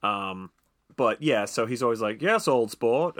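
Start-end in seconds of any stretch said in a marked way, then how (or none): 2.2-3.2 put-on old-Hollywood Old Sport, a bit higher and louder; mid-Atlantic accent. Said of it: "but he says it like that".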